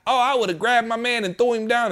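high, hammed-up armchair bravado; lightly mocking know-it-all voice; mock tough talk. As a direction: mimic a couch hero boasting. Mocking couch critics' bravado.

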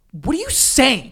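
outraged older sister, mock indignant; sister losing it. Sister scolding her brother, loud slow outrage. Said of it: "lost her mind. She was like".

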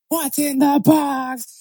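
very high, drawn-out mock wail; mocking movie quote. Friend mocking people who quote Seven, high slow parody. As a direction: squeal it slowly, parodying everyone who quotes it. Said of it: "then everybody's just".

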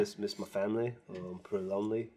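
soft, low retelling of a homesick friend; a little teary. Retold or imitated. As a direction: quietly imitate someone mildly upset, haltingly. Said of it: "he got a little teary".

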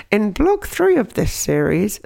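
lightly voiced slow questioning listener; a hint of a curious listener voice; impression of the listener. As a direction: mimic a listener raising a point, slowly. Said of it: "this is my impression of you".

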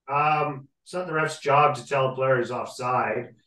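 normal talking voice

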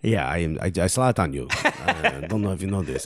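low, soft mock French accent; Zlatan French accent. Podcast host jokingly voices Zlatan in a French accent. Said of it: "French accent every time I do a Zlatan".

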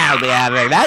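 loud laughing goblin shout; snatch it like a goblin. Retold or imitated. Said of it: "goblin voice".

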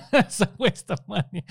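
high, loud words through laughter; amused, breaking up as you speak; laughing. Real, not acted. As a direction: laugh while admitting it. Laughs at own bad order.

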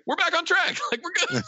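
high-pitched, excited announcement in imitation; mock excitement. Acting out relief over the phone.

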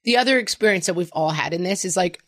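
ordinary delivery

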